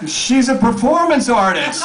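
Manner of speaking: high, mild yell. A narrator's reading. Friend explaining out the bus window, high yell. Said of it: "I'm yelling out the bus window".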